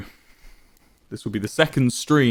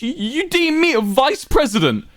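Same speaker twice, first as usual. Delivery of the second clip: higher, tight, barely contained anger; held-back rage. A narrator's reading. Wilbur insulted by the offer, holding back rage. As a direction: fight to keep the rage down. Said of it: "trying to contain his rage".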